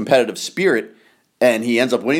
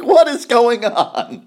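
high, loud, slow incredulous cry; amazed what is going on; disbelief; 13 semitones higher, 5 dB louder, slower than usual. Retold or imitated. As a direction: throw it up high in mock disbelief. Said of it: "I'm like".

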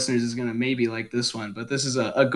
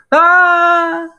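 high, loud scream of a fall; 6 dB louder than usual. Retold or imitated. Imitating Indiana Jones' scream falling off a cliff. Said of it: "sounds like he's jumping off a cliff".

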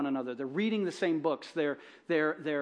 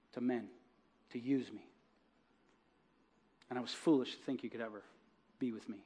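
much softer, lower, broken admission; quiet tearful confession; 5 semitones lower, 8 dB quieter than usual. Acted. Woman confessing to the man she loves, much softer and near tears.

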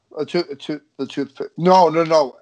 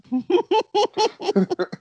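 high burst of laughter. Real, not acted. Laughing at a joke.

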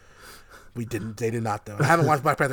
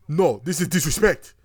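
hammed-up African accent, pitched up and louder; mocking the actor's African accent; 4 semitones higher, 6 dB louder than usual. Mocking an actor's fake African accent to friends, loud mock African accent. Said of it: "spoke in this disgusting African accent".

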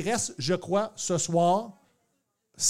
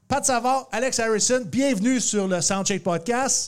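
announcer welcome; 5 semitones higher, 4 dB louder than usual. Acted. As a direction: present them like a game show host. Host welcomes guests again.

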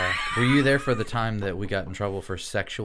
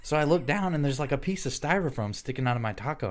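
ordinary delivery

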